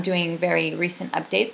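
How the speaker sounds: ordinary delivery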